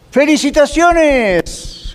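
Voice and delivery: hammed-up celebratory praise; mock congratulations. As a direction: congratulate grandly and very slowly.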